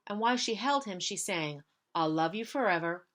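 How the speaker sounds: ordinary delivery, nothing marked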